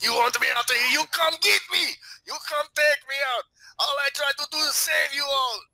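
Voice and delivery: high-pitched, ranting madman; crazy street person. Acted. Player playing a crazy street person challenging guards, ranting high and wild. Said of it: "go crazy street person mode".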